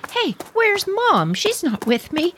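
lost pup wondering where Mom went; dog's inner voice. Pretend. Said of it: "Tony Dog realizes".

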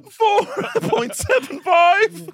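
high, slow, loud stalling count; mock counting parent. Parent dragging out the count to five. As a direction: stretch the count out teasingly.

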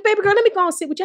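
quick high Cleveland lilt; Cleveland accent. Retold or imitated. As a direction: rush in kindly with Cleveland accent. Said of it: "I said".

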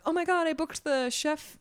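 high, gushing imitation of a thrilled actor; mock excitement. Host voicing actor who booked chef role, mock-excited. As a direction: squeal the good news, playfully exaggerated.

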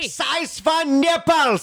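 very high, loud, drawn-out shout; hammed-up yell across a shop; mock yelling. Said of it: "now we're both yelling size one nipples".

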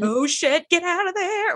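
high-pitched alarmed warning; mock panic at the character; yelling at screen.

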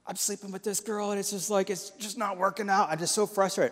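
a hint of a whiny confessor; complaining friend. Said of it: "they're gonna complain about a relationship".

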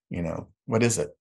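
usual speaking voice

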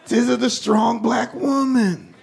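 slow, laughing amazement; awed disbelief. Retold or imitated. Kid watching mom win the fight, slow laughing awe. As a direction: drag it out in awe. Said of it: "I was like".

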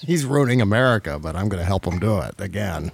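lightly put-on resigned politician, dropping low; passive-aggressive politician. Host mocks Barr backing Trump anyway, lightly passive-aggressive. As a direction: concede with a low, grudging mumble at the end. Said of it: "that passive-aggressive voice".

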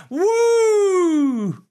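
long, very high, loud "woo"; spent, mock-excited cheer; sarcastic woo. Real, not acted. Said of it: "Was that a sarcastic woo?".

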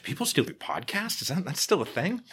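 high, surprised disbelief; incredulous. Pretend. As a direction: sound amazed anyone still bothers. Imagined future people surprised.

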